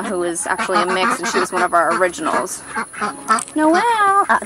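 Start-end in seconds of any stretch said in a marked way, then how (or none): 3.5-4.3 high call across the yard. Real, not acted. Owner calling her hen Noelle across the yard, high call. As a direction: sing out the hen's name.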